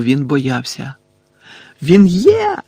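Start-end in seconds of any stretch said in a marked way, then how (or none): 1.8-2.6 high, loud desperate denial; short raised-pitch yell; shouting. A narrator's reading. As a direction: shout it in defiance. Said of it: "закричав Гаррі".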